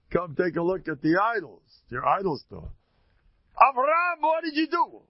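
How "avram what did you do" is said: high, loud shocked outburst; father's outraged cry. Acted. Terach finding his smashed idols, high loud outburst. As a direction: shout it like a father seeing the wreck.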